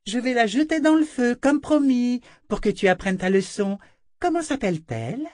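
high, gloating princess voice; bullying princess, taking pleasure in the threat; cruel princess. Acted. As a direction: threaten in a high, imperious girl's voice.

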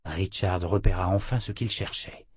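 ordinary delivery

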